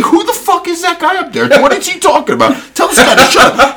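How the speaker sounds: high-pitched mock outrage, half laughing; imitated irate coach. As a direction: rant like a coach at a heckler. Acts out coach's angry outburst.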